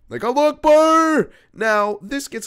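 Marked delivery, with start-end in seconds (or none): 0.2-1.3 very high, loud scream; imitating Billy screaming in game; screamed Allahu Akbar. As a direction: belt it out at the top of your voice. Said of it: "he would scream".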